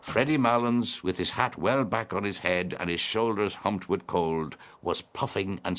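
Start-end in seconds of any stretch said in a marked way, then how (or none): none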